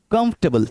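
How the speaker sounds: कंफर्टेबल squeezed to "kumf-tuh-bul"; three syllables. Shown as a demonstration. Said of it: "तीन syllables हैं".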